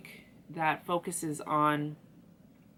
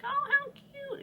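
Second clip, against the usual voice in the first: put-on very high, squeaky baby-talk coo; cutesy high squeal; 3 dB quieter than usual. Reader cooing over a tiny snail, high squeal. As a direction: squeal it like seeing something adorable. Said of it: "I was like".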